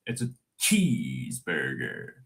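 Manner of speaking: "cheeseburger" with a long drawn-out "chee", low and slow; peculiar "cheeseburger". Shown as a demonstration. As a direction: stretch the first syllable of "cheeseburger". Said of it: "Very peculiar way cheeseburger is pronounced".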